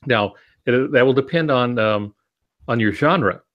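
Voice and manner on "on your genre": usual speaking voice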